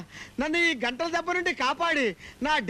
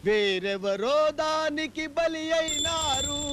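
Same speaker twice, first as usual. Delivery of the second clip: mock dramatic lament; 2 semitones higher, much slower than usual.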